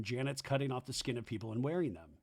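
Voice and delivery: ordinary delivery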